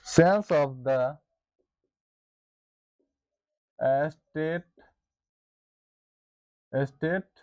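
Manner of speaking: slow, word-by-word dictation pace. Shown as a demonstration. Teacher dictating a definition to the class, slow and deliberate. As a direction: dictate each word slowly so students can write. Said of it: "teacher dictating definition for notes".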